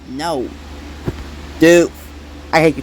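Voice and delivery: ordinary delivery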